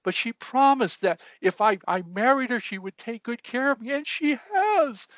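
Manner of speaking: high, blubbering old man, hammed-up; old man mock-weeping his story, pitched high; crying old man. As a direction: tell it through mock tears in a high voice. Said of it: "And he's crying".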